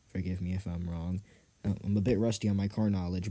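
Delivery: usual speaking voice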